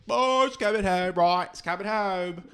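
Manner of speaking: high, loud chant of it's coming home; mock English fans screaming. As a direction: yell it like a terrace crowd. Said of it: "these British people screaming".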